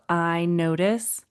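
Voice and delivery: slow, gentle "I notice" with a rise; drawn out, inviting the child to listen; slow calm voice. Shown as a demonstration. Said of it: "We're talking in a slow way, a slow pace".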